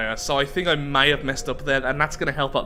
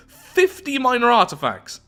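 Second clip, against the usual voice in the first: high-pitched exclamation, climbing into a drawn-out squeal; excited amazement; slower than usual. Real, not acted. Player stunned by fifty minor artifacts, excited squeal. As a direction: squeal over the windfall. Reacts to big game reward.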